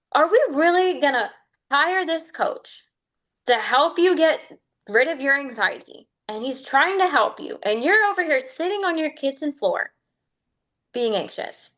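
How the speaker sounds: high, scolding self-talk, half-laughing; scolding herself. Retold or imitated. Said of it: "I got real mad at myself".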